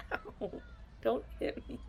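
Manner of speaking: ordinary talk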